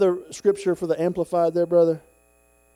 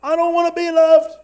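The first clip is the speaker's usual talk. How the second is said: acted shouted refusal; 13 semitones higher, 9 dB louder than usual. Grumpy man refusing God's love, shouted protest. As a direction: yell it stubbornly at heaven.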